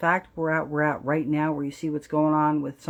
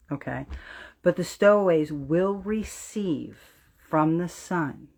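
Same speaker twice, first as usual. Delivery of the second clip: usual speaking voice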